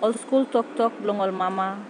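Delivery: spoken in Tok Pisin; papua new guinea pidgin. Acted. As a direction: recite in PNG pidgin. Tok Pisin poem verse.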